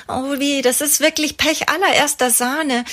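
hoher, klagender Mutterton; jammernd. A narrator's reading. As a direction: jammere über das Pech wie eine erschöpfte Mutter. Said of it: "jammert Ellas und Maltes Mama". (Mama jammert über den verstauchten Knöchel, höher und klagend)

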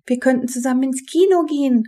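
quick, high-pitched suggestion; eager call. A narrator's reading. Henrietta proposes the cinema, calling out eagerly. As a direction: blurt out the idea keenly, fast and high. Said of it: "rief Henrietta".

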